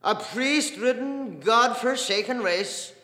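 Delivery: loud, high, drawn-out denunciation; bitter outburst. Acted. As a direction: condemn it slowly at full voice. Dedalus railing against priests.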